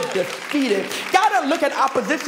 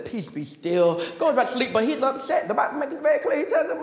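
normal talking voice

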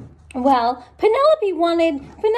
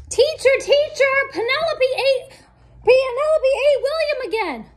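put-on tattling kid; 10 semitones higher than usual. Kids tattle to teacher.

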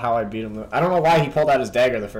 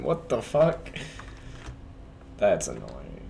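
ordinary delivery

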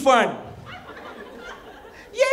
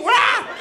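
parrot squawk; 6 semitones higher than usual. Acted. Imitating parrot cry.

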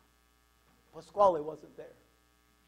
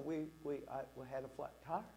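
soft, low, stammered excuse; mildly nervous, stumbling over the words; sheepish excuse; 6 semitones lower, 13 dB quieter than usual. Retold or imitated. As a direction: offer a meek alibi to her dad. Nervous excuse to girlfriend's stern dad.

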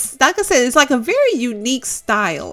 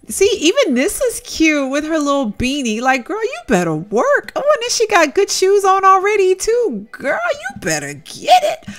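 squealing high over a cute outfit; delighted high-pitched fangirling; high cooing; 11 semitones higher, 5 dB louder than usual. Real, not acted. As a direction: gush in a high sing-song.

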